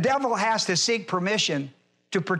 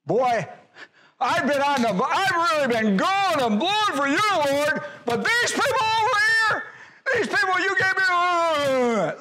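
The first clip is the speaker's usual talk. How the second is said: much higher, pleading complaint to the Lord; whining prophet; 9 semitones higher than usual. Retold or imitated. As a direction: plead and whine, half laughing at the end.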